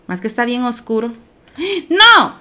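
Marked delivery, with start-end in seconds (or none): 1.6-2.4 high, loud yelp of "no"; startled cry. Real, not acted. Player reacting to a game mishap, startled cry. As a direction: cry out in sudden dismay.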